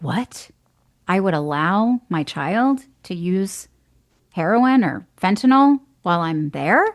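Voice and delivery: disbelieving parent, questions rising at the end; mock incredulous. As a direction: act out a parent balking at the idea. Voices a shocked parent's objection.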